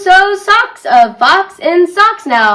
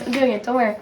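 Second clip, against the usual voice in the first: quieter, to herself; a much softer self-reassurance; softer repeat; 5 semitones lower, 10 dB quieter, much faster than usual. Real, not acted. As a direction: say it again softly to yourself. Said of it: "I'm doing it, guys".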